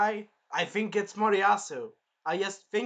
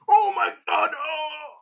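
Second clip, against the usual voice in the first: high, crying yell of pain; pained shout; 8 semitones higher, 7 dB louder, slower than usual. Retold or imitated. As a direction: howl as the shield hits the stomach. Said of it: "He's like".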